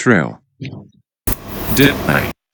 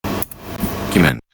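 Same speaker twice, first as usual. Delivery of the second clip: whispered word; 5 dB quieter, much slower than usual. Shown as a demonstration. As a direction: whisper the word Document softly and slowly. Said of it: "A document, official piece of writing".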